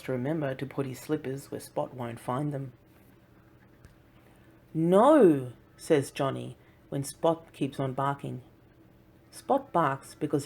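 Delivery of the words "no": firm no. Acted. Boy scolding barking puppy.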